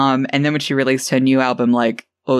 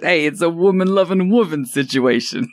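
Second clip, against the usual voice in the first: mock imitation; 4 semitones higher than usual. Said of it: "I love the way you just".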